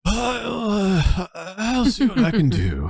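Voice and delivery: high, wavering elder; hesitant old priest; old man voice. Acted. Old cleric answers request.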